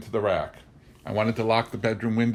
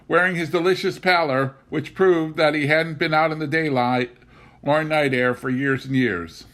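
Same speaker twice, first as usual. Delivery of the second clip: bitter; 6 semitones higher, 4 dB louder than usual. A narrator's reading. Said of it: "I reflected bitterly".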